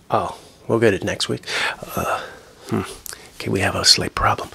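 usual speaking voice

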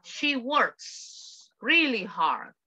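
slow, careful teacher model reading; slow model sentence. Shown as a demonstration. Teacher modelling a sentence slowly for students. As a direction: read it out slowly for the class. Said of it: "el verbo en presente simple se modifica".

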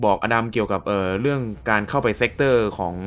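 ordinary talk, nothing marked

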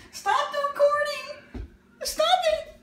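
high laughing plea. Real, not acted. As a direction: squeal through laughter. Laughing plea to stop filming.

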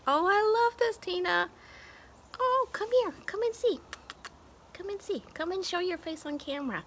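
squeaky pet talk coaxing the cat on camera; cooing to cat. Real, not acted. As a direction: coo at the cat to come.

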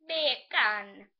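"Beer can" in a Jamaican accent, said loud like "bek an". Shown as a demonstration. Teaching viewers the Jamaican bacon joke, loud Jamaican accent. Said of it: "say bacon in Jamaican accent".

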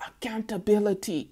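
whispered, soft and secretive. Pretend. Reader whispering the taboo word to Karmicville. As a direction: hush it like a forbidden word. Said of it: "I'll try not to say it so loud".

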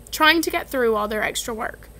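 usual speaking voice

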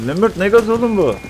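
high, loud panicked question; panicked shout. Real, not acted. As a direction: cry out at the gas. Gas attack panic. (panik içinde bağırarak)

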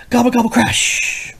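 high loud gobbling then crash; turkey gobble crash. Acted. Parent acting out crashing turkeys in a picture book. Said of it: "Turkeys banged into the house".

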